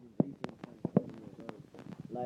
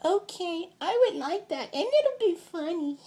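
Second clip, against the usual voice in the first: put-on much higher, giggly child voice; a delighted little kid, ending in a giggle; little girl voice; 3 dB louder than usual. Cousin happily agreeing to the plan, high giggly child voice. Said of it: "she's like".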